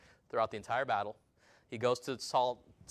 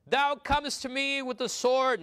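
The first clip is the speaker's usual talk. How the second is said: David's defiant reply; 11 semitones higher, 5 dB louder, slower than usual. Acted. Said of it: "Then said David to the Philistine".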